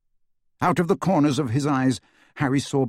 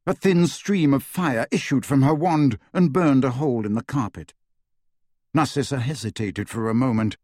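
usual speaking voice

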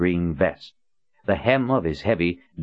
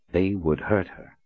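ordinary delivery, nothing marked

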